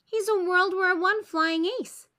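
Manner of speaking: proudly explaining the costume; a kid voice explaining. A narrator's reading. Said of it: "Charlie Brown explains".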